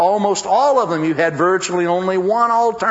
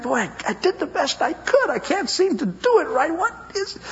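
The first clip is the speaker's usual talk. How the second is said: high, pleading frustrated voice; exasperated inner voice; 12 semitones higher, 3 dB quieter than usual. Acted. Preacher voices a husband or wife feeling like a failure, high and exasperated. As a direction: voice someone at their wits' end, pitched up. Voicing a discouraged listener's thoughts.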